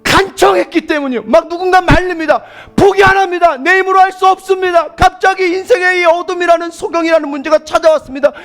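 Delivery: loud, high, urgent sermon shout; full-force preaching. Real, not acted. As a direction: cry it out with relentless insistence.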